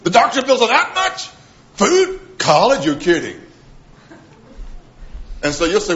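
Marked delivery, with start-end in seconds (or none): 0.0-3.4 put-on a parent reeling at what children cost; shocked at the costs. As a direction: pitch jumping up in disbelief at each bill. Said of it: "and you go".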